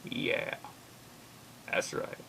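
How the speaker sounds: hushed, creepy mock agreement; barely voiced, nodding along as a lurker; very soft joking murmur; silent approval. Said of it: "silently nodding and going".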